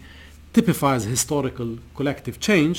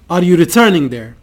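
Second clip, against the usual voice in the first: louder, firm question; mildly forceful interrogation; demanding; 2 semitones higher, 8 dB louder than usual. A narrator's reading. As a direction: press for an answer without shouting. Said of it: "he demanded".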